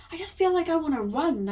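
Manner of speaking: high, eager rush of energy; like you could sprint off right now; bursting with energy. Real, not acted. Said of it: "hear the change in my voice".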